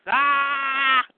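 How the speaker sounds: drawn-out mock yell; fight cry. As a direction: yell briefly as a guard in battle. Guard yells during acted fight.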